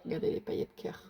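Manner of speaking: breathy unvoiced whisper. Real, not acted. A vlogger showing glitter hearts to viewers, whispered. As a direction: whisper it as if sharing a small secret.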